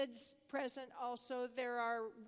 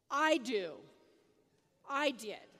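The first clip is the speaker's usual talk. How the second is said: short, louder imitated answers; retold emphatic admission; 7 dB louder than usual. Boy admitting his own pressure to mother, imitated emphatic outburst. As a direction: punch each short answer like an upset child.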